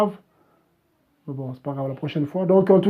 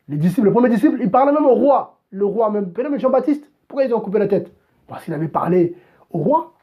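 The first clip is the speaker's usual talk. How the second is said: fiery preaching; 3 semitones higher than usual. Acted. Preacher urging his live audience to speak out, fiery preaching. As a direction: drive it home like a pulpit sermon. Preaching about the apostles' boldness.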